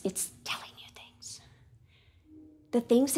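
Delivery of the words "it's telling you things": secretive whisper; hushed, as a voice inside the head. Shown as a demonstration. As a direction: whisper it confidentially. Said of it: "this voice that's whispering thoughts".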